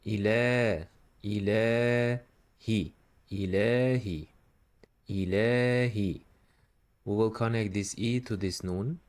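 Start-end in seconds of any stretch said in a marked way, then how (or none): 0.0-2.2 "Ile" with a long final vowel; stretched vowel. Shown as a demonstration. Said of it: "you should stretch the vowel". 3.3-6.2 "Ilahi" with a long middle vowel; stretched vowel. Shown as a demonstration. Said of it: "you should stretch the vowel".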